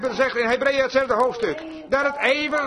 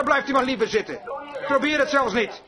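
ordinary talk, nothing marked

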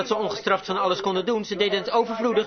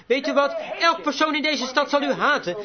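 higher-pitched imitation of an accusing crowd; retold jeering; 6 semitones higher than usual. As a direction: confront the judge as the townspeople. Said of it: "ze jouwden hem uit". (verwijtend, hoger nagedaan)

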